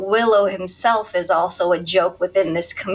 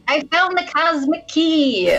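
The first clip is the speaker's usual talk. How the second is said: high-pitched character quote; mock-heroic movie line; Gwildor; 7 semitones higher than usual. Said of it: "'Cause he plays Gwildor".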